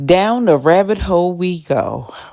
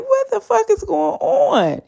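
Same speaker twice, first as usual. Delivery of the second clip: bewildered. Retold or imitated. Sissy's bewildered stare.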